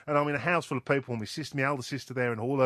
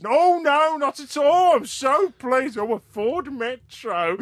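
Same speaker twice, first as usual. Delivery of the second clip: much higher, louder gushing voice; delighted prize winner; 11 semitones higher, 6 dB louder, slower than usual. Retold or imitated. Prank victim thrilled about publicity, gushing high voice. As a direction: gush like a thrilled dupe. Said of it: "We say to him now".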